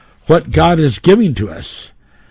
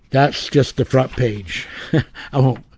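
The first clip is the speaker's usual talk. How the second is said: ordinary talk, nothing marked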